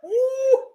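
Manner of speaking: very high, loud "ooh"; excited ooh. Real, not acted. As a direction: squeal with delight at the replay. Reacting to dunk replay.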